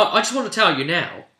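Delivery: usual speaking voice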